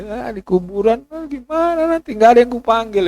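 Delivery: high, rising anguished wail; anxious lament. Retold or imitated. Preacher imagines his lonely grave, crying out high. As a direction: fret about the grave with a climbing voice. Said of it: "datang istri".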